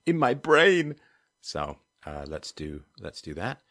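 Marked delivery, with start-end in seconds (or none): 0.1-1.0 sudden loud, high exclamation; mock-dramatic burst. Real, not acted. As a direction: burst out with it, comically overdone. Thinking aloud, mock-dramatic burst.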